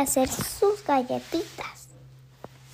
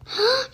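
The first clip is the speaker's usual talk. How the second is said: startled scream; 12 semitones higher, 5 dB louder than usual. Acted. Children startled by footsteps.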